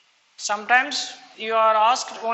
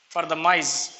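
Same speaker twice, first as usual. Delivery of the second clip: ordinary talk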